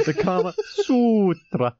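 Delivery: slow, drawn-out mock Swedish accent; mimicking fake Swedish accent. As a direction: tease the reader's dodgy Swedish accent, stretching SUTRA. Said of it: "What was that again, He-, Heather?".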